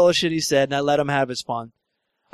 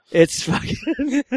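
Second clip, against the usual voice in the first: laughing; 8 semitones higher, much slower than usual. Real, not acted. Laughing mid-story.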